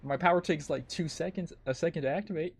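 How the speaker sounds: usual speaking voice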